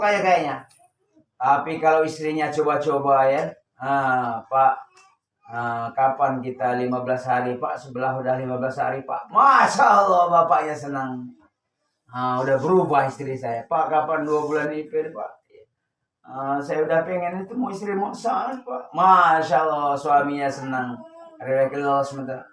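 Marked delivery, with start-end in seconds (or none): 3.8-9.3 low, soft wheedling plea; coaxing wife. Acted. Wife coaxing husband to go out fifteen days, wheedling softly. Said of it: "Ya, rayuan". 13.7-18.9 soft, low cajoling; coaxing wife. Acted. Wife nudging husband toward a two-month trip, coaxing softly. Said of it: "Ya, rayuan".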